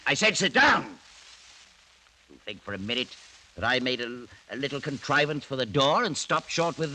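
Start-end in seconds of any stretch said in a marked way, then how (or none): 0.1-0.8 loud, sharp order, higher; angry command. Acted. Old lord ordering his nephew to sit, angry. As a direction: snap the order so he obeys.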